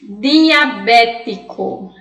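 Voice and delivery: "Diabético" very slow, with a hard D and a hard T; Spanish pronunciation. Shown as a demonstration. Teacher slowly models "Diabético" the Spanish way. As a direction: stretch out "Diabético" with a clean D and T. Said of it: "Notem que nessa palavrinha".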